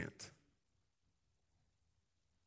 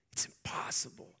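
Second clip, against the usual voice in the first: whisper; 4 dB quieter than usual. Acted. Angel voiced in sermon.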